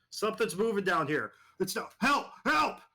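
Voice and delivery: rising, fast terrified cries for help; panicked screaming. Acted. Soldier screaming over the radio as something attacks, panicked. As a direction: scream for help as it gets you.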